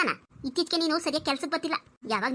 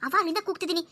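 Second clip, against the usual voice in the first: ordinary talk, nothing marked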